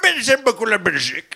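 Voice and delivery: high, laughing Québécois character voice; teasing the Belgian audience in a hammed-up Québécois accent. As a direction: ham up the Québec yokel, cracking up. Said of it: "c'est un personnage québécois".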